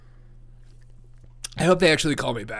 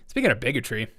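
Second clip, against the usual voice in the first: ordinary talk, nothing marked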